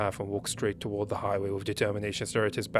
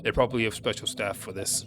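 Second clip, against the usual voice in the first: ordinary talk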